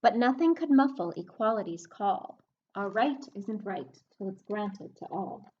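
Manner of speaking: storybook reading voice; reading a rhyming book to kids. Acted. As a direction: read the verse to the class, leaning on the rhyme. Teacher reads rhyming book aloud.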